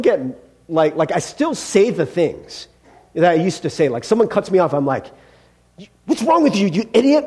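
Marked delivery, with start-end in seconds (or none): 6.0-7.2 put-on old angry way. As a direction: let the old temper fly. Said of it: "that's the way I used to say it".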